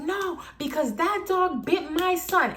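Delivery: high, slow, whiny-insistent imitation; complaining mother. Storyteller mimics the mother blaming the dog, high slow protest.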